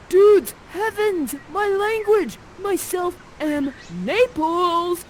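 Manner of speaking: high, loud, drawn-out astonishment; hammed-up amazed young prince; Ferdinand. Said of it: "Ferdinand, you respond".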